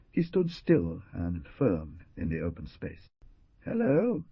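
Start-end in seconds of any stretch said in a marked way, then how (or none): 3.6-4.2 high, taunting call; mocking shout. A narrator's reading. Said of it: "he shouted mockingly".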